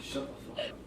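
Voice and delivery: whispered annoyed snap. Real, not acted. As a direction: hiss it under breath. Annoyed at teasing friend.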